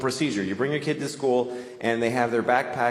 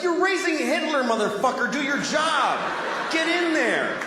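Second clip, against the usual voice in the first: yelling at parent; 13 semitones higher than usual. Retold or imitated. To the weak mother, yelling to do her job, scolding. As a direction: bark orders at her to do her job. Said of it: "'Cause it's like".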